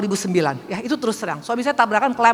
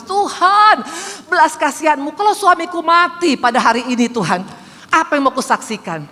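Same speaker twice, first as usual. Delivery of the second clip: desperate prayer; 6 semitones higher, 4 dB louder than usual. Retold or imitated. Her desperate prayer retold.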